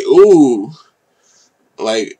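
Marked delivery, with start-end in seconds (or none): 0.0-0.7 impressed ooh. Real, not acted. Reacting to a hard bar with a loud impressed ooh. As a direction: let out a big impressed ooh. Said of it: "I'm like".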